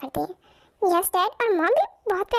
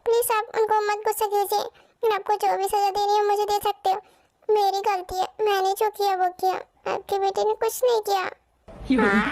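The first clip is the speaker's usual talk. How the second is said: ordinary delivery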